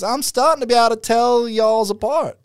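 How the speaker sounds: put-on high-pitched American country accent; country American woman. American woman chatting with an Australian visitor, high country accent. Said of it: "very American, like, country-ish woman".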